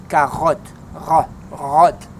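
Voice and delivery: carotte with a throaty French R, then rotte repeated alone; teacher drilling the R in carotte; guttural R. Shown as a demonstration. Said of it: "to make it kinda guttural".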